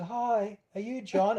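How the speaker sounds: high, soft voice of a kindly lady; polite greeting. Retold or imitated. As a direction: imitate a gentle, tentative hello. Voices therapist at car window.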